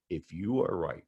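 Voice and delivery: low, hushed, measured retelling; soft, weighty and sinking in pitch; solemn. Retold or imitated. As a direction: imitate a friend's grave admission, voice dropping away. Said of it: "he looked at me solemnly".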